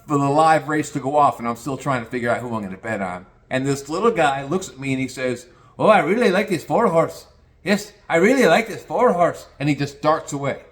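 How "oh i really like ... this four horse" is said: lightly voiced Mexican jockey tip; quick eager tip in a light accent.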